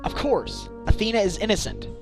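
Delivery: high, emphatic protest; indignant defence. Acted. Standing up for an accused friend, indignant. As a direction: insist on it without a doubt.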